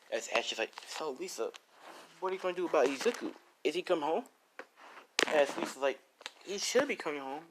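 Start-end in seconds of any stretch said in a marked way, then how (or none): 0.9-4.2 put-on higher-pitched girl's voice; lightly acted high-pitched questioning; curious girl friend. 6.4-7.4 put-on higher-pitched girl's voice; lightly acted high-pitched reply; Lisa. Lisa answers her friend.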